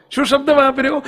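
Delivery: ordinary delivery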